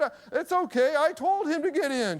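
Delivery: high-pitched voicing of an older woman. Older woman to worried father, high-pitched reassurance. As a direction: wave off the worry in a high old lady's voice.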